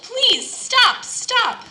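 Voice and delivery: high, loud desperate yell. Acted. Teacup in the hot oven, high loud yell. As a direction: yell to escape the heat. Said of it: "I yelled".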